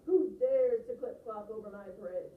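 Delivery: loud slow troll bellow. Acted. The Troll bellows at the biggest goat on his bridge. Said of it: "bellowed the Troll".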